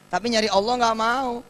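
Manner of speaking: a preacher swelling into full force; impassioned preaching. Acted. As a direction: press the crowd harder with each phrase. Sermon builds to climax.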